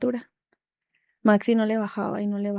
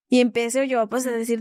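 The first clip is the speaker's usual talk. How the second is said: ordinary talk, nothing marked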